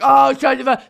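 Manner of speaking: high, loud mock whining; hammed-up indignant burst; loud protest. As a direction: imitate his blustering outburst. He protests as she leaves.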